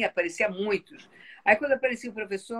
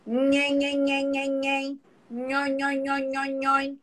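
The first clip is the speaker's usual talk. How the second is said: higher buzzing nhen and nhon syllables; nasal voice exercise; 4 semitones higher, slower than usual. Shown as a demonstration. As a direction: hum the syllables like a vocal warm-up. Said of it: "que exercício você faz com essa voz".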